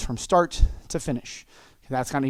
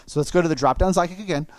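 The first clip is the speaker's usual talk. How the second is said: ordinary talk, nothing marked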